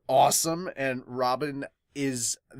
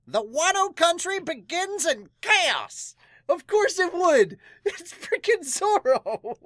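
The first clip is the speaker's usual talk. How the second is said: much higher, slow, louder hype; hyped announcement; 5 dB louder, slower than usual. Real, not acted. Fan hyping up Zoro's chapter, much higher and louder.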